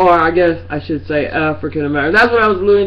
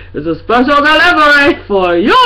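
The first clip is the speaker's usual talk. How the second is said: higher, louder mock announcer; 7 semitones higher, 5 dB louder than usual. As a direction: announce it like a delivery man.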